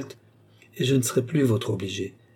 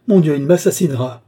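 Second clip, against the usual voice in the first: loud, high cry of terror; raised, pitched-up desperate scream; hysterical howl; 5 semitones higher, 8 dB louder than usual. A narrator's reading. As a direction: howl it out in a fury of panic. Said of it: "hurla la jeune femme".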